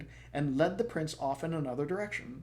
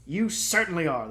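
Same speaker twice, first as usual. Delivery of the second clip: panting king; 5 semitones higher, 8 dB louder than usual. Acted.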